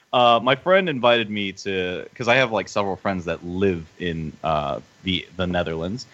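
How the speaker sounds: ordinary delivery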